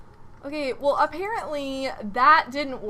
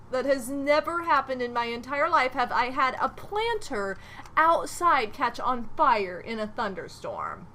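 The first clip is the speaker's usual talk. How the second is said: normal talking voice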